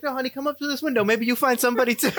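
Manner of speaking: put-on high old-man coaxing voice; Pop coaxing. Pop calling girl up to his window, high coaxing. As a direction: coax her up to the window. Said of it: "He's like".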